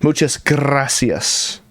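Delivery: drawn-out rolled R in gracias. Shown as a demonstration. Showing a student how to roll an R, long trilled gracias. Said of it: "this is a rolling an R".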